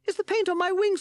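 very high, loud little bat voice; high squeaky bat. A narrator's reading. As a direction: squeak it out anxiously. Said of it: "squeaky voice".

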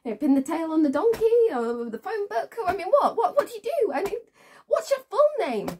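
high, loud, mounting interrogation; agitated demand. Acted. Woman demanding his new full name, agitated and loud. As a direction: fire questions at him, voice climbing in indignation.

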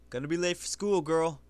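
a loud call down the street. Acted. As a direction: shout the warning across to her. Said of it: "Somebody called".

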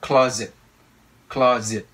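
"Closet" as CLAW-zit, falling; stress on first syllable. Shown as a demonstration. Teacher drills learners on first stress, "Closet" punched up front. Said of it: "Âm nhấn đầu tiên ha".

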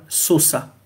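"sosa" with an s sound at the start; French misreading. Shown as a demonstration. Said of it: "quand on est francophone, on va lire".

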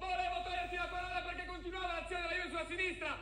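ordinary talk, nothing marked

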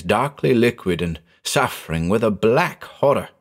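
ordinary delivery, nothing marked

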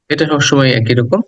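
ordinary delivery

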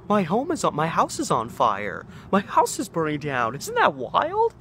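high, loud and gleeful, chuckling through the news; laughing it off. Retold or imitated. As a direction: tell it like a big joke, amused. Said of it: "laughing about it".

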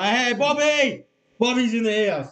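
loud, slow, high hail; excited greeting. Real, not acted. Welcoming a viewer named Bobby into the stream, loud. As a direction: hail him like a regular at the pub.

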